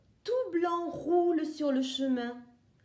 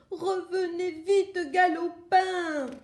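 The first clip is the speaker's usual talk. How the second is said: higher, louder alarmed call; panicked hen shouting after the egg; jumpy, urgent yell; startled cry; 5 semitones higher, 5 dB louder than usual. Acted. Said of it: "Elle s'écrie et sursaute".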